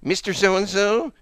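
high, drawn-out voice of a lady on the intercom. Retold or imitated. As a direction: call out like a school intercom page, high and slow. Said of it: "the lady comes over the intercom".

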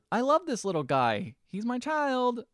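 high cooing voice; cutesy voice. Retold or imitated. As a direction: coo like to a puppy. Retelling video girl.

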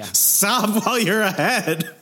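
slow, laughing through the words; laughing warning to a rambling cohost. Real, not acted. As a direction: tease him while you laugh.

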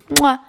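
big smacking kiss sound; showy sign-off smooch; loud blown kiss. Pretend. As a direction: send listeners a loud, exaggerated kiss.

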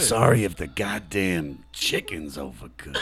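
slow loud gruff movie-star rant; Al Pacino impression. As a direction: growl it slow like a worn-out tough guy. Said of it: "you wanna hear my Al Pacino".

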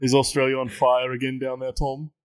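light British accent, mocking Americans. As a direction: roast the Yanks in a mild British voice. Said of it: "I'll become British for you right now".

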